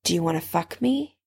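softly inviting, rising at the end; hushed question offered as an invitation; low invitation. A narrator's reading. Severus asks Harry, softly inviting. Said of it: "his voice low with invitation".

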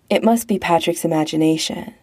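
ordinary delivery, nothing marked

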